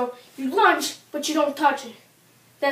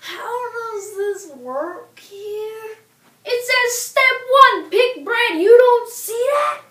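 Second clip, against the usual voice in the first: lightly voiced bewildered woman, slow; a hint of a lost first-timer; confused customer; 7 semitones higher, 6 dB louder, much slower than usual. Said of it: "she was like".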